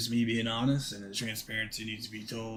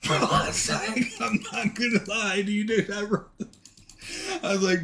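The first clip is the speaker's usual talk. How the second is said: laughing; 10 semitones higher, 6 dB louder than usual. Real, not acted. Laughing while telling the story.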